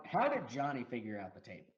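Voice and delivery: ordinary talk, nothing marked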